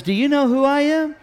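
high, rising question thrown back; mock challenge. Usher asking the pastor's mother back, mock challenge. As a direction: toss the question back, pitched well up.

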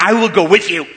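hammed-up movie line; movie-quote parody. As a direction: blurt it like an eager film heroine. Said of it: "doing the Jerry Maguire movie".

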